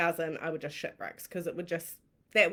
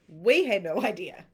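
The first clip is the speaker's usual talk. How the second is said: ordinary delivery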